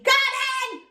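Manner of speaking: high excited call; shouted. A narrator's reading. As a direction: sing out that you heard. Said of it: "I shouted".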